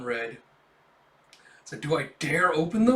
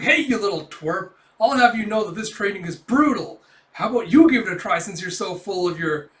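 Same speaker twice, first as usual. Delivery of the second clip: louder, higher indignant shouting; hammed-up huffy outburst; mock angry yell; 4 semitones higher, 8 dB louder than usual. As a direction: bark it like an offended grown man. Kafka snaps at the kid.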